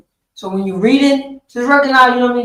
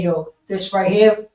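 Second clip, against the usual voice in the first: ordinary talk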